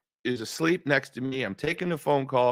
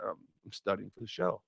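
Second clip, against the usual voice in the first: quiet; 5 semitones lower, 13 dB quieter than usual. Retold or imitated. Wife asleep, answering phone early.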